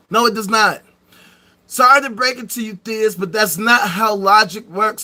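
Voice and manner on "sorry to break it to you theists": normal talking voice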